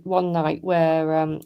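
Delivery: ordinary talk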